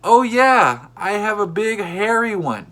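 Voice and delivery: high, loud yell back; yelling back to a stranger, loud and high; yelled reply. Retold or imitated. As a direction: shout it across the park. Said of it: "I yelled back".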